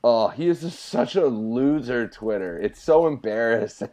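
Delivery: ordinary talk, nothing marked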